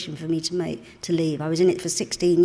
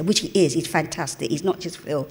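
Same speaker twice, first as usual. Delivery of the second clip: normal talking voice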